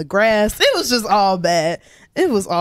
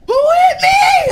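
drawn-out high, loud bewildered yell; slow, shouted "who hit me" from a dazed boxer; 10 dB louder, slower than usual. Retold or imitated. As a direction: play a knocked-out guy coming to, yelling who hit him. Dazed friend waking up confused.

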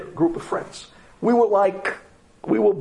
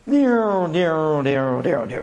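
imitated siren wail; 2 semitones lower, much slower than usual. Siren heard from the car at a red light, imitated wail. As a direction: mimic a police siren, near near rising and falling. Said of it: "we hear".

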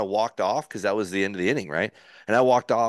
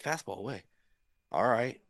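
much softer, reluctant acceptance of the call; hushed doubtful echo; 6 dB quieter than usual. Retold or imitated. Pitcher taking the catcher's call, quiet and reluctant. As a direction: say it softly, half to yourself.

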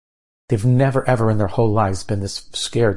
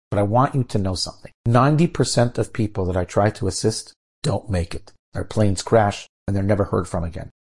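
normal talking voice

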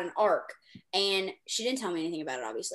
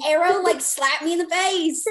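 higher and louder, mock-stunned; incredulous outburst; 8 semitones higher, 6 dB louder than usual. Real, not acted. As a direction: blurt it out like you're still reeling. Laughing about being unprepared.

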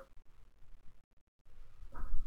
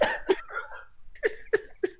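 wheezing laugh; 6 dB quieter than usual. Real, not acted. Breathless laughter.

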